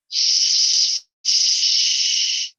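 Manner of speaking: unvoiced "Shh, shh" hiss; shh sound. Shown as a demonstration. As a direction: push air out in a long shh. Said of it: "the S H sound".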